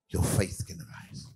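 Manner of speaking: slow, breathy near-whisper. Acted. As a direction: let the words fall to a hush. Whispered climax of the point.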